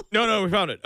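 mock cop. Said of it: "the cops are like".

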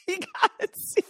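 squeaky laughing echo. Real, not acted. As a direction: laugh the words out high.

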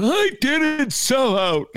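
high, slow, put-on whiny denial; protesting boomer. Imagining a boomer listener objecting, high whiny denial. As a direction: play a boomer who takes offense. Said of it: "there's gonna be one boomer".

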